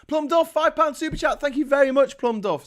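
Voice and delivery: high, loud super chat shout-out; excited announcement. Real, not acted. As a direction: call out the donor's thanks at high pitch, full volume. Announces and thanks a donor.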